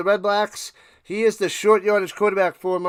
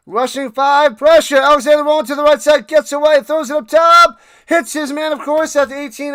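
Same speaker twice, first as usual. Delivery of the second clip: much louder and higher, racing then slowing; excited play-by-play; 6 semitones higher, 8 dB louder than usual. Acted. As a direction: call the big pass like a thrilled announcer. Streamer calls the play live.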